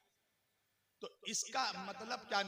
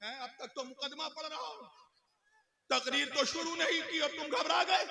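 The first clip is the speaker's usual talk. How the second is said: usual speaking voice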